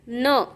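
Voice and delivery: न as an isolated "nuh"; phonic N sound. Shown as a demonstration. Teacher showing the sound of N, न as "nuh". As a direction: sound out न, not the letter name. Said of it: "यनचा उच्चार होतो".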